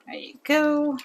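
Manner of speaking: whisper. Real, not acted. Finished shaking the drink.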